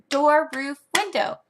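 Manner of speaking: speeded-up sing-song list. Shown as a demonstration. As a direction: rattle the list off briskly for the kids. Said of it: "And then get faster".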